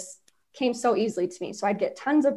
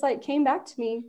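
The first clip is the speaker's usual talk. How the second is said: usual speaking voice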